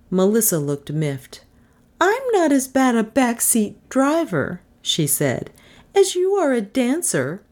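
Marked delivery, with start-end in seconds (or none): 2.0-4.6 high-pitched, miffed woman's voice; miffed Melissa. Acted. Miffed passenger answering the driver, high-pitched. 5.9-7.4 high-pitched, miffed woman's voice; miffed Melissa. Acted. Miffed passenger answering the driver, high-pitched.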